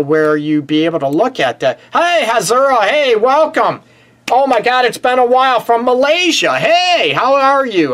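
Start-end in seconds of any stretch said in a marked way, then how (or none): 1.9-7.9 high, excited welcome; delighted greeting. Real, not acted. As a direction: greet a long-missed friend with a burst of energy. Greets a viewer who joins live.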